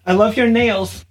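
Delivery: sweet voice for daughter. Real, not acted. Dad praising toddler's nails.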